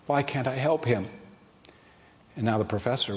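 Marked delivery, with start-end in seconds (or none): 0.1-1.1 soft, tearful little plea. Acted. Girl in tears begs the professor, softly tearful. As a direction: ask through tears, softly. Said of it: "she begins to cry".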